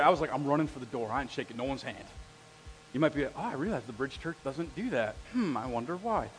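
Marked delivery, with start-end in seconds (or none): 0.3-2.1 muttered mock dread. Shy kid fleeing greeting time. 3.4-6.3 mock wondering aside. Visitor musing.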